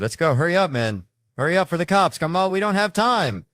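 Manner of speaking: loud, high, urgent yell; snapping. Retold or imitated. Friend rushing [name] away from the cops, snapping. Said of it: "Then he snaps on me".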